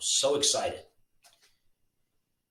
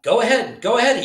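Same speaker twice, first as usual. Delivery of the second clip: much higher and louder urging; crying out; 13 semitones higher, 12 dB louder, faster than usual. A narrator's reading. Elf friend urging Dot to grab the gift, loud high call. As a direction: yell it out to spur her on. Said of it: "he cried".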